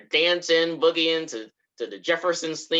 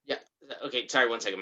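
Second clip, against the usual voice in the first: ordinary delivery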